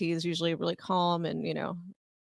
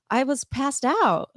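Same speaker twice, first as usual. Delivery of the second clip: slow, higher drawn-out protest; defensive whine; 6 semitones higher, 4 dB louder, much slower than usual. Retold or imitated. She protests to her boyfriend, drawn out slow. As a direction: stretch it out, pleading innocence. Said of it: "defending herself".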